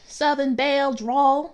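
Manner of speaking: slow, loud Southern belle drawl; imitated Southern drawl, heavy and slow. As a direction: draw it out like a mock Southern belle. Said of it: "to a lower pitch".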